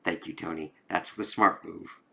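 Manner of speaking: cold and calm, barely above a murmur; understated threatening calm; quiet menace. Retold or imitated. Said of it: "I'm like".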